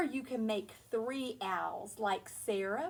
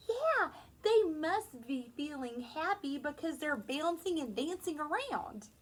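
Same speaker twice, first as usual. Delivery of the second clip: ordinary delivery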